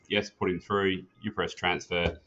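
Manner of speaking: ordinary talk, nothing marked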